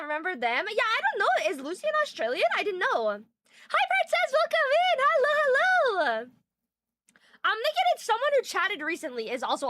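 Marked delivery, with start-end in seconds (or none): 3.7-6.3 high, bright hello to a viewer; excited greeting. Real, not acted. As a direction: welcome her in with a lift.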